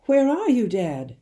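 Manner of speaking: high, loud searching call. A narrator's reading. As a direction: call out, looking around. Boy calling, can't see father.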